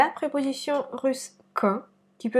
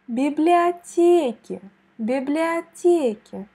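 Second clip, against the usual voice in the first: библиотеке slow and louder with the accent on те; stress on библиотеке; 3 semitones higher, 5 dB louder, slower than usual. Shown as a demonstration. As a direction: drag out библиотеке, hit те. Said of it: "On met l'accent ici". (библиотеке lentement, accent sur те)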